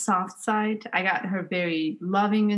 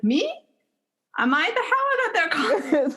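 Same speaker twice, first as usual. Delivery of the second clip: laughing mock surprise; 9 semitones higher than usual. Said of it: "She'll be like".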